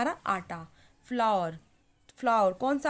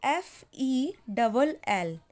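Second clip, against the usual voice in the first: slow dictation spelling; much slower than usual. Shown as a demonstration.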